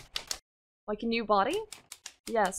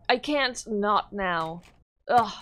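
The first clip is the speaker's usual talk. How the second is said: ordinary talk, nothing marked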